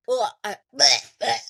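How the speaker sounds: loud mock gagging sounds; hammed-up nauseated bleh; disgusted retching noises. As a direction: gag at the smell, played up for laughs. Disgusted by meatball smell.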